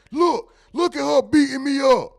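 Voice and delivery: high, loud mock whimper of a beaten man; imitating the scary guy saying something soft; mocking soft plea. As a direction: whine it like a tough guy getting beat. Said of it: "say something that soft".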